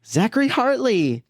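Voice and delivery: put-on stiff, high line reading like a child actor; bad child actor. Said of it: "Just a bad child actor".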